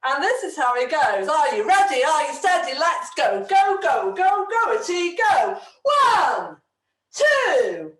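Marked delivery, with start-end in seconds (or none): 1.3-7.9 loud, high hype-up count; excited countdown. Acted. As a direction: rev the kids up for the dance. Leading children into a dance.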